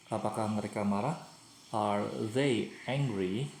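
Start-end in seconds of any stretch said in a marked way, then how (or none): 1.7-3.5 slow, deliberate English question; slow model question. Shown as a demonstration. Teacher shows pupils the moved verb, said slowly. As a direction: spell out the question slowly for the class. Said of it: "Kata kerjanya dipindahkan ke depan".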